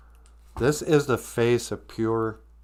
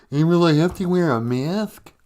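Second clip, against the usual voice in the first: lightly put-on, higher puppet voice; mock Big Bird; 5 semitones higher, 4 dB louder than usual. Host mimicking Big Bird's mask question, mock puppet voice.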